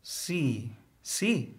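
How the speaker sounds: slow letter-by-letter reading; sounding out the word. Acted. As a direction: spell it out haltingly, sound by sound. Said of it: "Sounding out the word".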